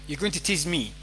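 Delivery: usual speaking voice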